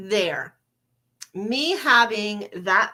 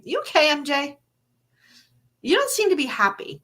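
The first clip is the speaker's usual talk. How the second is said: partner asking gently; 6 semitones higher, faster than usual. Retold or imitated. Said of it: "my beautiful H train would say".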